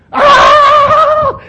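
comic shriek of pig terror; pig's terrified shriek. Retold or imitated. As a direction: squeal like a panicked pig. Pig sees demons coming.

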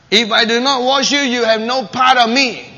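loud and firm; stern Jesus. Acted. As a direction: lay down the condition firmly.

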